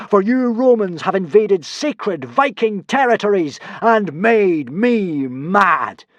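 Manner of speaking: slow, loud, high wrath; bellowing god. A narrator's reading. Hiccup as a god bellowing threats at the Romans. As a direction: scold the invaders like an angry god. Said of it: "bellowed Hiccup".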